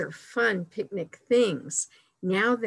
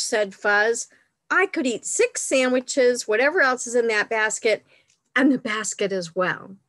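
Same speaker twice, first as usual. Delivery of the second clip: delighted exclamation; 2 semitones higher, 4 dB louder than usual. A narrator's reading. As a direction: exclaim it brightly as a storybook bear. Reads picture book to children.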